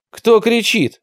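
high-pitched, mildly angry challenge; angry demand. A narrator's reading. Leader challenging whoever cried out, angry. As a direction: demand it with some anger. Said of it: "гневно спросил Тимур".

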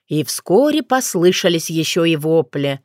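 usual speaking voice

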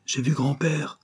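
put-on mildly insist, as a small boy; insistent child. A narrator's reading. Grandson repeating his news to mother, mildly insistent. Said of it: "affirmait le petit-fils du banquier".

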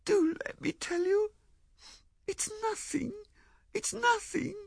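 high, tearful pleading; wailing. Acted. Tearful Ethel begs Lewisham to let her explain, wailing. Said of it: "she wailed".

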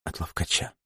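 very hushed, barely voiced answer; timid confession. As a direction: let the name out almost soundlessly. Бланка робко признаётся Джерри. (еле слышное признание)

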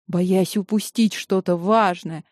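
ordinary delivery

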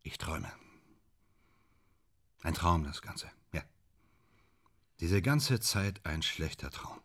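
low, soft, sunken voice; deflated admission. Acted. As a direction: let the euphoria drain into quiet bitterness. Euphoria collapses into bleak admission.

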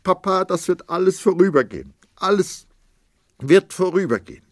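high, loud, rushed pleading; wife reassures her husband and father in a fever of fear; feverish reassurance. A narrator's reading. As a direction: rush to comfort them both.

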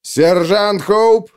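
loud, slow summoning shout; calling out. Acted. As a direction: bellow the sergeant's name. Captain calls the sergeant.

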